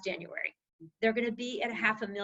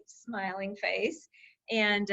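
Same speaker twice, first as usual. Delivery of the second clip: ordinary delivery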